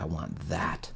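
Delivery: hushed, longing whisper; whispered want. Retold or imitated. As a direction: breathe it out like a craving. Craving after success.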